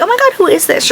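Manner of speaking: high fake-clueless brush-off; mock rude reply. Pretending not to recognize the coworker's number, mock snub. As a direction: act like she's a stranger, snidely. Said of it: "I should have been mean".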